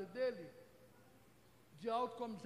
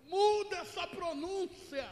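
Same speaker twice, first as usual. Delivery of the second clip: husband's correction; 8 semitones higher, 8 dB louder, slower than usual. Retold or imitated. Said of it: "Meu marido falou".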